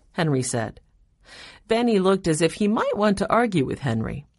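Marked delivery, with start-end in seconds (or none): none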